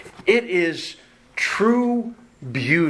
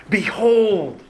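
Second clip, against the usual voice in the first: Behold exclaimed, higher and louder; 7 semitones higher, 5 dB louder than usual. Shown as a demonstration. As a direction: cry out behold as a summons. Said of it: "should have an exclamation mark".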